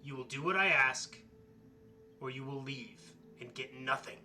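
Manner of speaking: loud, impatient witch ultimatum; angry hag. Acted. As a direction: bark the ultimatum like a fed-up hag. Hag loses patience with players.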